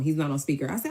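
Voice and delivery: usual speaking voice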